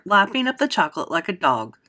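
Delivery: usual speaking voice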